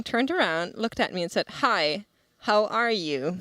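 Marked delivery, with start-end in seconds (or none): 1.6-3.4 "Hi," then a pause, "how are you" fully separated; imitated pointed, careful greeting; slowed-down repeated greeting. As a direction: greet slowly and deliberately, word by word. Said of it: "slowed down what he was doing ... said".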